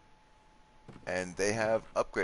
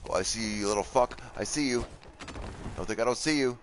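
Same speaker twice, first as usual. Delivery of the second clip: taunting threat; 6 semitones higher, 3 dB louder than usual. Real, not acted. Taunting an enemy in game.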